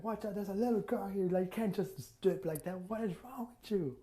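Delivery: high, outraged telling-off; indignant scolding. Acted. Scolding Gray for stripping near a little girl, high indignant.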